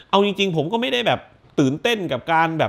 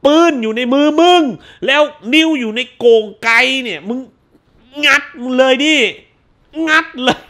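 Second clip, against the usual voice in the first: loud, higher exasperated rant; shouting at the screen character; 6 semitones higher, 4 dB louder than usual. Real, not acted. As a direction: scold the character hard. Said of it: "กูด่าแม่นะ".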